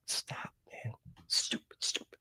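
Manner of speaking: whispered to himself, cross; self-scolding whisper. Real, not acted. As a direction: hiss it at yourself like nobody should hear. Scolding himself under breath.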